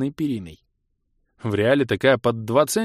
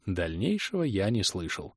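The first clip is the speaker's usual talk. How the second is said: plain reading voice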